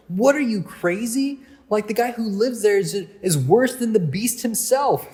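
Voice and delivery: high-pitched mock disbelief; incredulous retort. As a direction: scoff like alarmed kids warning a friend. Acts out team's alarmed retort.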